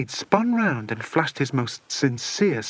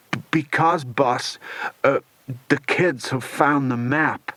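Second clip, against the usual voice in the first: nervous stammer. Acted. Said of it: "Igor swallowed painfully".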